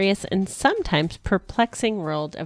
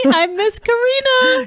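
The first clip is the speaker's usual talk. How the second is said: excited; 6 dB louder than usual. Real, not acted. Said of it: "That's me".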